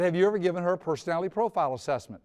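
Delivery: fast, slightly laughing repeat; rapid-fire repeat. Retold or imitated. Friend repeating to puzzled father, fast question. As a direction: rattle it off again quickly. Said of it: "He said that so quickly".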